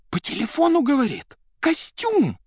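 high-pitched, incredulous exclamation; hysterical telling. A narrator's reading. Secretary reports the suit talking, hysterically. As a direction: jump high on the last word.

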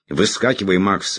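barked. Acted. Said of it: "рявкнул он".

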